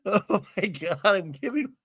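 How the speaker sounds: real giggles between words; laughing fit. Real, not acted. Host overcome by the silly story, real laughing fit.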